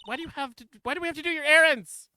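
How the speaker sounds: high, loud indignant outburst; indignant protest. Acted. Sidekick objects to doing the producer's errands, indignant.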